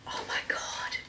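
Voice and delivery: soft, hushed rapture; quiet breathless oh my God; awed whisper. Real, not acted. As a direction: whisper it in awe. Savouring the bag.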